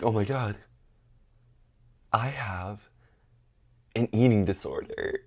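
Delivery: quiet, halting mock revelation; hushed dramatic self-diagnosis; grave realization. Acted grave self-diagnosis, before panicked repeat.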